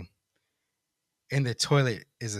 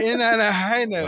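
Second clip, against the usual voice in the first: mock triumphant; 10 semitones higher, 7 dB louder than usual.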